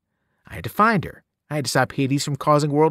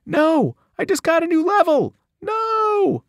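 very high, loud desperate wail; shouting protest; 5 dB louder than usual. A narrator's reading. Satyr dragged from game, shrieking high and loud. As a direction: yell like a kid losing his game. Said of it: "as he shouted".

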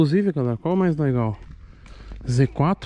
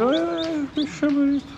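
excited; 11 semitones higher than usual. Real, not acted. Delighted at a pretty animal.

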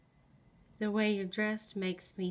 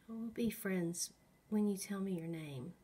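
usual speaking voice

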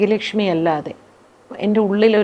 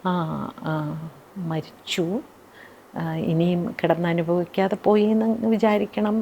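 softer, hushed confiding tone; softly, in private; 4 semitones lower, 10 dB quieter than usual. Retold or imitated. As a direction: lean in and tell it privately, softly. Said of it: "പതുക്കെ എൻ്റെ സ്വകാര്യത്തിൽ പറഞ്ഞു".

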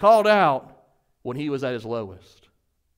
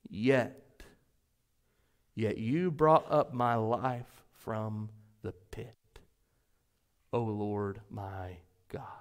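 slow, whispered prayer, much softer; hushed whisper; 7 semitones lower, 7 dB quieter, much slower than usual. Acted. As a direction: breathe it out like a secret plea to God. After "But catch this", the climax of the prayer.

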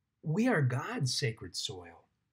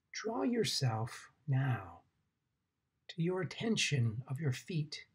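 guided meditation. Acted. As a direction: guide them gently into the ground, unhurried.

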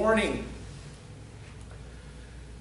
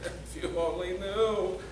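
soft, laughing, indulgent aside; God laughing softly at foolish people; amused chuckle; 7 dB quieter than usual. Retold or imitated. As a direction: chuckle at a child's boast. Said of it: "He's just going".

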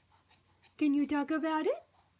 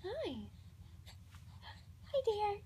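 ordinary talk

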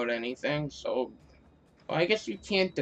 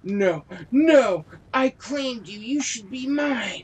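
higher, petulant villain voice; possessive boss; 6 semitones higher, slower than usual. Acted. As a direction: claim him like a spoilt goddess.

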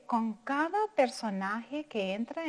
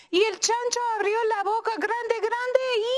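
faster and much higher, building; building excitement; 10 semitones higher, 4 dB louder than usual. Acted. Teacher reaching the story's climax, excited and rising. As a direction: rush toward the climax.

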